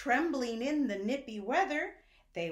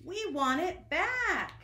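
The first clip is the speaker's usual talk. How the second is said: high, louder sheep plea; high sheep protest; sheep crying out; 8 semitones higher, 4 dB louder than usual. Acted. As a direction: whine it like an upset sheep. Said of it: "they cried".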